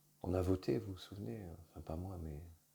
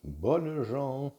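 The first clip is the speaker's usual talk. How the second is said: loud ringing proclamation, pitched up; town crier; 6 semitones higher, 10 dB louder, much slower than usual. Pretend. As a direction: cry it out like a herald in the square. Opening proclamation call, oyez oyez.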